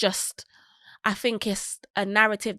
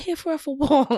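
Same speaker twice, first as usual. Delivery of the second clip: incredulous scoff; 7 semitones higher, 4 dB louder than usual. Retold or imitated. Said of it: "I was like".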